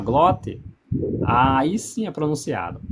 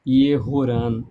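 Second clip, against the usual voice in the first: ordinary talk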